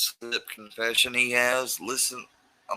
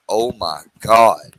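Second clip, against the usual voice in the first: exasperated outburst; 12 dB louder, slower than usual. Real, not acted. Exasperated at the other guest.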